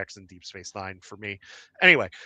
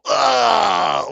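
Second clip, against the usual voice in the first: loud, high throaty "ugh"; Klingon grunt of disgust; 10 semitones higher, 9 dB louder than usual. Acted. Comedy fan retelling a Klingon food joke, loud disgusted groan. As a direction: imitate a revolted Klingon's outburst. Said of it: "Klingon just look at it and just go".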